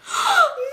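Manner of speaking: sharp acted gasp of shock; mock horrified gasp. Storyteller reliving hearing three shots, dramatic gasp. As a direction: gasp as if shots just rang out.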